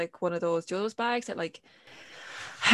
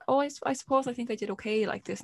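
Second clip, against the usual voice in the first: softer, higher, hesitant little reply; unsure answer; 5 semitones higher, 7 dB quieter than usual. Retold or imitated. Child answers presenter in practice run, unsure. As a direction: give a tentative, unconvinced answer. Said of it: "say it a bit more, kinda, like, more positive".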